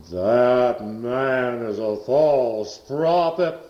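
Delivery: very slow, drawn-out accusing demon voice; demon's man voice. Retold or imitated. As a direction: drag each word out like the voice possessing her. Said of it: "a man's voice came right out through her vocal cords".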